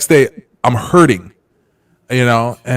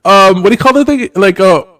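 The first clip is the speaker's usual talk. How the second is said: higher, louder boastful mock voice; mocking the friend's lawsuit claims, higher boastful voice; 6 semitones higher, 5 dB louder than usual. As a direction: brag like a smug blowhard. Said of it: "right back what he said".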